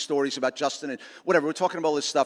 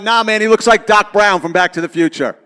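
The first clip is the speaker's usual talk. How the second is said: a louder call from the back, laughing through it; mock yell; 9 dB louder than usual. Speaker retells a kid calling out across the hall, mock yell. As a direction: shout it out across the auditorium, lightly. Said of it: "yells out".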